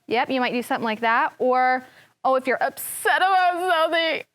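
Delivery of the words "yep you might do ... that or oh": usual speaking voice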